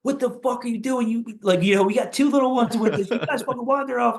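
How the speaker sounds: high-pitched mock yell at the in-laws; imitating wife screaming. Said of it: "She starts fucking screaming at them".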